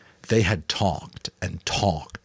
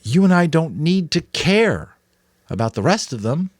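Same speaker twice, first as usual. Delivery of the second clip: higher, louder youthful worry; Jonas, anxious boy; 11 semitones higher, 6 dB louder than usual. A narrator's reading. Jonas telling the Giver it is no use, anxious. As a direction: protest that it is hopeless. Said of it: "Jonas suggested".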